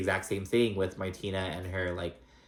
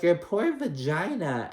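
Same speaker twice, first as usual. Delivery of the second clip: high, slow mock sympathy; mock pitying Lisa; 8 semitones higher, slower than usual. Podcaster imitating Lisa's pitying reply, slow. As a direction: drag it out with fake pity. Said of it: "And Lisa's like".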